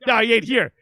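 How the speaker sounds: high, loud flat refusal; dad telling the stranger no; dad refusing. Retold or imitated. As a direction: shut him down. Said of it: "My dad's like".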